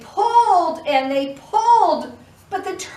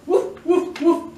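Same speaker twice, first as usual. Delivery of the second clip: light doggy woofs; a quick playful bark for the children; 3 dB louder than usual. Acted. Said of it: "The dog helped too".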